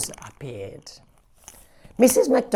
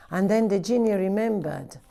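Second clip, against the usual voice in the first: ordinary delivery, nothing marked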